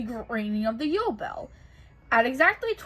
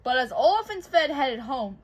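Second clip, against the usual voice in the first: ordinary delivery, nothing marked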